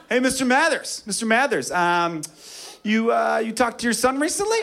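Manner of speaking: high, mock-innocent needling voice; teasing coworker. Warehouse friend needles [name]'s dad at lunch, teasing. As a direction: pitch it high, feigning a casual question. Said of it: "All of his friends give him a hard time".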